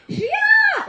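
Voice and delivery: high, excited shout of a name; calling out. Retold or imitated. Kids spotting her at breakfast, calling out. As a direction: shriek it out like delighted kids.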